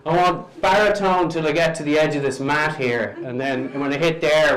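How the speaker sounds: normal talking voice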